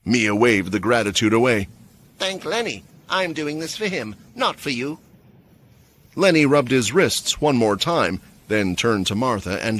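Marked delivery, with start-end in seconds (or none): 2.2-5.0 cool, dismissive woman. A narrator's reading. Mia waves gratitude away.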